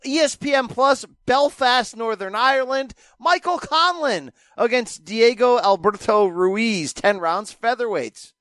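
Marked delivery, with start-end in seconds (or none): none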